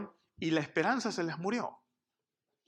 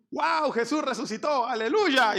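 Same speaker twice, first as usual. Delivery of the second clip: much higher, mock jubilant outburst; joyful disbelief; 7 semitones higher, 5 dB louder than usual. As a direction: cheer like a thrilled believer. Imagined joyful reaction, then denied.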